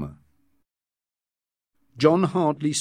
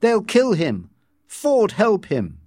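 high, raised-voice alarm; fearful; 10 semitones higher, 4 dB louder than usual. A narrator's reading. Bernard to Helmholtz watching the mob, fearful. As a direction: dread what comes next, pitch up. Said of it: "eyes wide open in fear".

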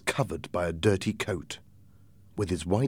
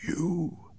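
soft, higher, stunned single word; very quiet voice; 9 semitones higher, 6 dB quieter than usual. A narrator's reading. Captain sees the blind man, very quiet. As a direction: let it out softly, pitch lifted. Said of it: "in a very quiet voice".

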